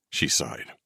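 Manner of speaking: ordinary delivery, nothing marked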